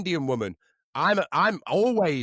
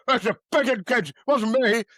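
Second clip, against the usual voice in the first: loud defensive Pete; 6 semitones higher, 6 dB louder than usual. Retold or imitated. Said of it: "And then he's like".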